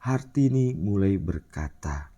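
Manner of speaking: plain reading voice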